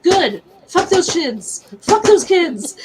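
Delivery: emphatic re-enacted anger; retold angry outburst. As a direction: vent hard about the kids. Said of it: "I was so angry".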